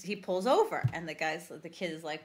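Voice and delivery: ordinary delivery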